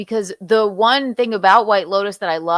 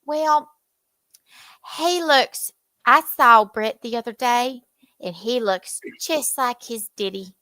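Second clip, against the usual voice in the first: South Carolina small-town twang; Rock Hill accent. Shown as a demonstration. Gossiping about seeing Brett the other day, Rock Hill twang. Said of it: "That is a Rock Hill, South Carolina accent".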